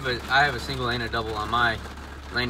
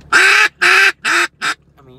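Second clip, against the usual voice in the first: loud high hail call on the double reed; duck call honks; 13 dB louder than usual. Real, not acted. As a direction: blow hard, quacking honks. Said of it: "You can't really hail call with this double reed".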